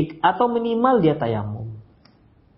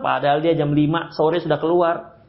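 ordinary talk

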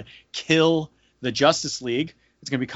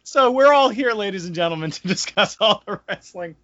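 announcer voice; 6 semitones higher than usual. Acted. Podcast host intro.

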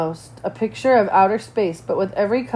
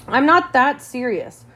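slow, higher, trying to sound happier; forced cheer; 5 semitones higher, slower than usual. A narrator's reading. Girl hiding her sadness from friends, forcing cheer. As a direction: put on a brighter voice than you feel. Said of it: "So I try to sound happier".

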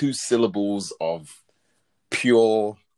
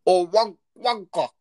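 much higher, louder fake British "wanker" with a long ah; botched British accent; 11 semitones higher, 5 dB louder, slower than usual. Podcaster mocking Americans faking British, botched British accent. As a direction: overdo a posh British "wanker" the way Americans get it wrong. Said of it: "try and say it in a British accent and then they end up messing it".

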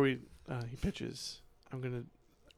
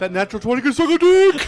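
loud, triumphant, higher yell; gleeful shout; 8 semitones higher, 14 dB louder than usual. Real, not acted. Mocking the rolled twenty.

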